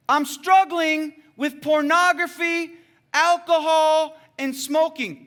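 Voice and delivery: high, slow re-enacted yell; yelled confession. Boy on the rock confessing his struggles to the men below, yelling. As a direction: shout each item out for all to hear. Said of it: "He yelled it".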